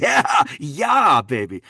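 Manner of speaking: high, loud gleeful whoop; elated shouted "ja, ja"; excited German-style yes. Retold or imitated. Keen reply about German role.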